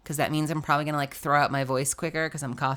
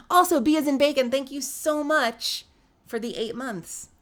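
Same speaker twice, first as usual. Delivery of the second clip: excited thanks; 7 semitones higher than usual. Real, not acted. Streamer thanking a viewer for eight months, excited. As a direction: pitch it up brightly and lean on the thanks.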